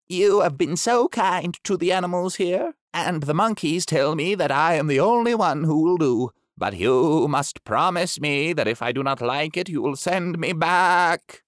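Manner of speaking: lightly voiced shy beast; gentle creature agreeing. Pushmapulya speaks to doctor.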